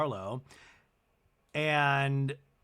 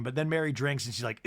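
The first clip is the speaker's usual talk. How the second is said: ordinary talk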